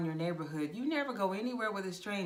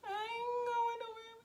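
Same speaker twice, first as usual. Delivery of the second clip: very high inner voice; 4 dB quieter than usual. Retold or imitated. Her inner refusal.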